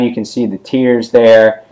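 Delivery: ordinary talk, nothing marked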